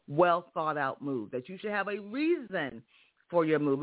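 usual speaking voice

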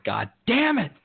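high mock curse from the ex; imitating his angry ex, pitched high; mock angry girlfriend. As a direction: squeak it like the angry ex. Ex reading the messages.

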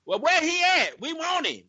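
high, pushy townsfolk voice; demanding crowd. Retold or imitated. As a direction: demand to know where he is.

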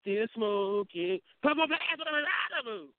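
high, drawn-out rapper impression. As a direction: stretch the words out high, hamming up a rap delivery. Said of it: "Mystical say".

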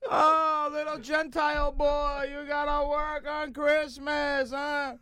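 very high, drawn-out teasing coo; slow, high-pitched mocking sing-song; mock pity. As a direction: coo with fake sympathy to rub it in. Mimics guests teasing the doorman again.

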